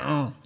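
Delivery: soft, contented mock groan of a dog; a low, pleased hum like a beagle getting a treat; happy dog groan. Preacher imitating his beagle Charlie hearing about cookies, happy groan. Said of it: "exactly like that".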